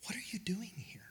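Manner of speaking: a breathy whispered call from close by; hushed whisper. Acted. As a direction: whisper the question softly. Preacher voicing God's question.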